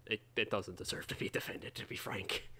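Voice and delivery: whispered aside; whispering. Real, not acted. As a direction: whisper it as a confession. Whispered aside about melee.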